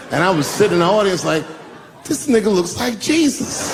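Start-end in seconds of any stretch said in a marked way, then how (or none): 2.1-3.8 higher, slow, amazed; awed. Retold or imitated. A comic sitting in the audience sees Obama glowing, slow high awe.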